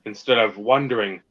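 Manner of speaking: usual speaking voice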